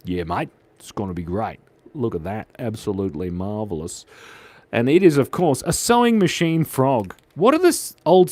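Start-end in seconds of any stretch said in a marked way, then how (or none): 0.0-4.0 laid-back Australian mate, pitched down; put-on Australian, low and easy; Australian accent. Shown as a demonstration. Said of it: "I can do an Australian accent".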